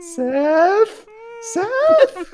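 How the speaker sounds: high, frightened call for Seth; mock scared call. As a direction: whimper his name, pretending fear. Frightened calling out in jest.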